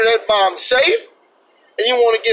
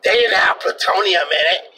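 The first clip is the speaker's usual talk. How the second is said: mocking the cops; 2 semitones lower than usual. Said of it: "And they were like".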